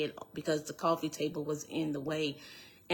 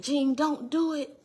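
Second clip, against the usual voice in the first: high-pitched, alarmed retold plea; urgent plea; 9 semitones higher, 4 dB louder than usual. Woman retells begging the gunman not to shoot, alarmed. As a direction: throw the voice up high, begging him to stop.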